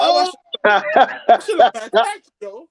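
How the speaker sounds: laughing through a scornful put-down. Retold or imitated. Mocking the girlfriend's watch gift, laughing. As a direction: scoff at the gift while laughing.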